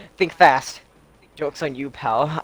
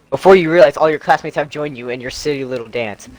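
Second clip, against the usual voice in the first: ordinary talk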